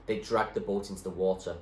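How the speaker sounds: plain reading voice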